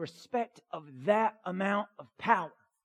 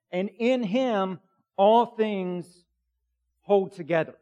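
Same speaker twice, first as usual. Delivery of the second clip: building scripture reading; 5 dB louder than usual. Acted. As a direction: let the verse swell to a climax. Reads Colossians aloud.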